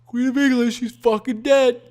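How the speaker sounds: high, emphatic mock betting call; loud, high mock proclamation; hyped-up crowd imitation. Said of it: "Everybody's like".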